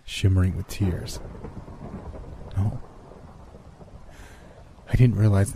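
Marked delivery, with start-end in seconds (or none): none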